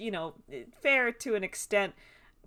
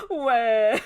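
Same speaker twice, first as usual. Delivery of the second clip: loud comic wail, laughing; playful "waah" imitation of crying; mock crying; 3 semitones higher, 9 dB louder than usual. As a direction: bawl like a cartoon crybaby. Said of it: "him just crying immediately".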